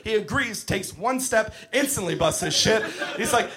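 ordinary delivery

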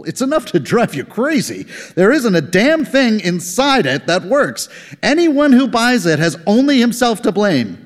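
high, exasperated coworker rant. Acted. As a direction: vent like a fed-up colleague. Rogler ranting at lunch.

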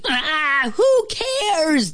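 very high, dismissive cry; interrupting outcry. A narrator's reading. Ant, flea and fly cutting off the moth, shrieked. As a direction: cut in with a high squeal. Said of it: "all said at once".

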